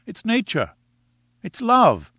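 Ned insisting to Gladys that it's love; Ned, ardent lover. A narrator's reading. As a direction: pour out the words as a smitten young man. Ned pleads, pitched high.

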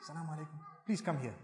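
soft, polite and calm; a soft, respectful call to the helper; gentle request. Shown as a demonstration. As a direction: ask her kindly, quietly. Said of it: "you need to say".

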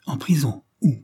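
plus aigu, stupeur soudaine; s'écrie surpris. Acted. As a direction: question incrédule lancée d'un coup. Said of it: "s'écria monsieur Lidney". (surprise choquée)

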